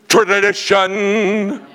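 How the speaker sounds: Tradition stretched very slow and high; slow, high, loud Tradition; drawn-out tradition. Acted. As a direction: let the word Tradition ring out slowly. Drawn-out punchline word.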